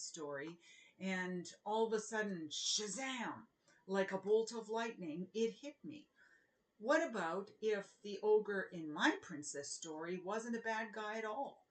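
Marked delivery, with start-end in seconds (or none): none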